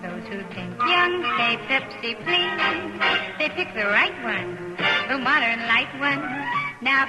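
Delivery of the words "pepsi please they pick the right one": normal talking voice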